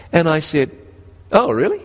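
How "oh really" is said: high, lifted oh really; surprised child. Retold or imitated. Boy realizes his teacher made it easy, surprised. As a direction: brighten up in sudden understanding.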